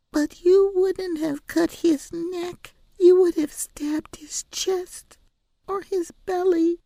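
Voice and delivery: put-on high, slow child's voice; small girl reasoning it out; little girl arguing. A narrator's reading.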